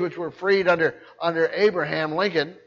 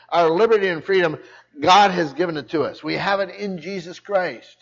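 normal talking voice

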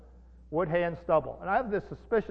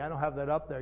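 ordinary talk

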